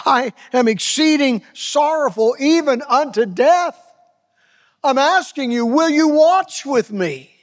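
anguished plea. Acted. Jesus voiced pleading in Gethsemane.

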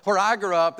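ordinary delivery

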